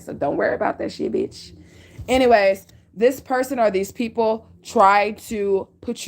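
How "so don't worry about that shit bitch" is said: dismissive whisper. Real, not acted. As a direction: whisper it, brushing them off. Aside to stalkers.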